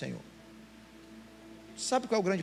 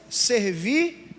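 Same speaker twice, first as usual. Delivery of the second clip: loud rising "Servi" called out to the church; emphatic; 5 semitones higher, 8 dB louder than usual. Shown as a demonstration. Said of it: "bota aqui pra mim". ("Servi" em voz alta e subindo)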